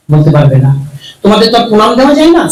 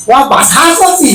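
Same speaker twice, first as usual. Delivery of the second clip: mock surprise; 7 semitones higher, slower than usual. Comic mishearing, exaggerated surprise.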